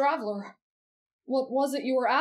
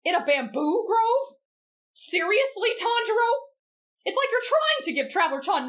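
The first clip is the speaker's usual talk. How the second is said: incredulous; 8 semitones higher than usual. Real, not acted. Scolding yesterday's story.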